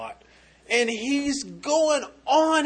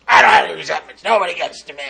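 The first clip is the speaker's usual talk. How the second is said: deep gravelly ex-Navy chief voice; loud, gravelly denial; gruff tough guy; 2 semitones lower, 9 dB louder, faster than usual. Retold or imitated. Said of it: "Deep, gravelly voice".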